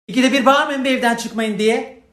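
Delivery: indignant shouting rant; angry rant. Real, not acted. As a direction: blow up at them, loud and high. Furious rant at the authorities.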